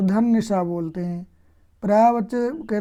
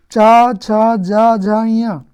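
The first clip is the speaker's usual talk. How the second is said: loud, emphatic letter drill; loud recitation; 2 semitones higher, 11 dB louder, much slower than usual. Shown as a demonstration. Teacher drilling students on the cha-row, loud recitation. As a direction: ring out each syllable loudly.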